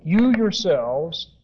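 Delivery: "You" stressed and held, "yourselves" slowed down; slow down and lean on "You"; emphatic stress on You. Shown as a demonstration. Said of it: "And it's very emphatic".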